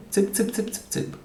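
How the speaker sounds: rapid, high-pitched string of clucky calls; demonstrated chicken-calling sound. Shown as a demonstration. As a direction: call hens in a quick repeated patter. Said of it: "this is the sound that you make to call".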